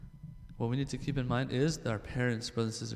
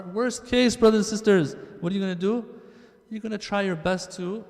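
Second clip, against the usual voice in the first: higher and louder, rallying the crowd; rousing appeal; 6 semitones higher, 5 dB louder than usual. Real, not acted. Preacher urging brothers and sisters, loud and rousing. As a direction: lift it out over the congregation.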